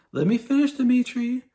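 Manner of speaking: high, pleading squeak of a president; flustered president impression. President pleading with the Russian premier on the phone, high and flustered. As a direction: cut in high and insistent to get a word in. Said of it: "he's like".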